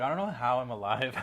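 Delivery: loud, laughing through the words. Real, not acted. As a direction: laugh in disbelief at yourself. Laughing at own old diet.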